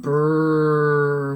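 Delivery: "brr" drawn out very slowly; slow stretched blend. Shown as a demonstration. Teacher corrects "bite" to "brr" for the class, slow. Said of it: "This says bite".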